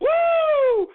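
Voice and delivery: very high, loud laughing whoop; gleeful whoop. Real, not acted. Host gloating over the angry fans, laughing whoop. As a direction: let out a triumphant hoot.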